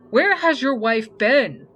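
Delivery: loud, slow, high-pitched demand; accusing head nurse. A narrator's reading. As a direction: confront him as a gruff nurse. Gruff head nurse confronts husband.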